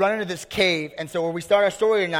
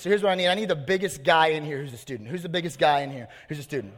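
ordinary talk, nothing marked

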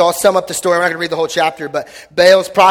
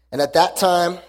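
scripture reading; 3 semitones lower than usual. Acted. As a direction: read it as a reverent prayer. Reads scripture prayer aloud.

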